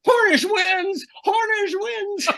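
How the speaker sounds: high, drawn-out mock shout; imitated excited shout. Announcer imitating his analyst blurting out the race winner, excited shout. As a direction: shout the win out, stretched and high-pitched. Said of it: "you hear Rusty shout out".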